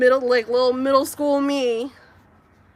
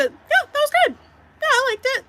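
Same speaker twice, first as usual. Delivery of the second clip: much higher, mock unimpressed husband voice; imitating husband; 6 dB louder, much faster than usual. Wife mocking husband's unmoved reaction, high mock voice. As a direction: mimic a shrug of a reaction. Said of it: "he is very much a".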